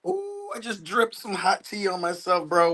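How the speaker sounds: surprised yelp. Real, not acted. Spills hot tea on himself.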